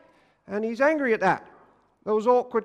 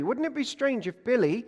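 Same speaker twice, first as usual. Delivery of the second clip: usual speaking voice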